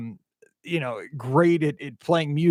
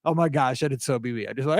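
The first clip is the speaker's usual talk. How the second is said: normal talking voice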